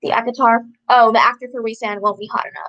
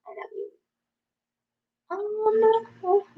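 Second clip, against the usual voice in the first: cooing to dog; 11 semitones higher, 6 dB quieter, much slower than usual. Real, not acted.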